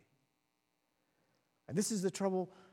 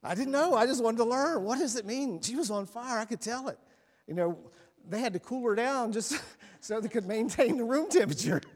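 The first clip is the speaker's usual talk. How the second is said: excited retelling; 8 semitones higher, 8 dB louder than usual. Real, not acted.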